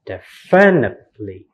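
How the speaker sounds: "definitely" as de-FIN-itely; wrong second-syllable stress. Shown as a demonstration. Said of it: "It's not in second syllable".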